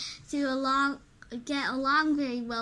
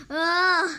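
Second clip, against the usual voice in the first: loud straining ugh; a loud hero's ugh of effort; effortful groan; 4 semitones higher, 9 dB louder than usual. Acted. Super Potato straining to think.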